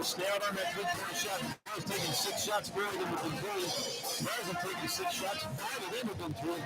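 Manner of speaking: ordinary talk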